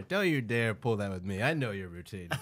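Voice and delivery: ordinary talk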